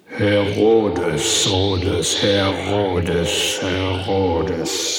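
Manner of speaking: loud, eerie repeated ghost calls; children calling spookily over each other; ghostly calling.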